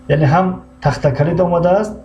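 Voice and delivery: ordinary talk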